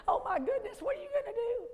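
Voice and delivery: high-pitched mock panic; hammed-up fretting, pitch climbing high; freaking out. Preacher imitating the enemy's anxious voice, mock panic. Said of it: "he's not freaking out".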